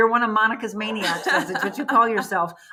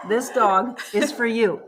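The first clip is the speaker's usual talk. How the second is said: ordinary talk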